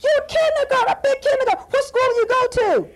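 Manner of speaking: very high, gushing clown voice; excited clown host. Acted. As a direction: marvel at the little guests in a high voice. Clown host quizzes the children.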